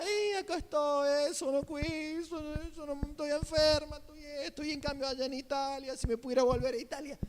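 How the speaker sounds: put-on high, whining lament of an old immigrant woman; mock tearful, high-pitched complaint; whiny old Italian woman. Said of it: "la mujer llegaba y comenzaba a llorar".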